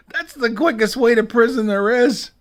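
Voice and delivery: high-pitched, loud yelling warning; outraged yell. Real, not acted. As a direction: yell it way up high, full of alarm. Mock outrage at threatening the FBI.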